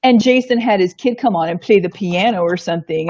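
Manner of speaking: usual speaking voice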